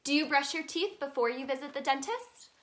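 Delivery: ordinary delivery, nothing marked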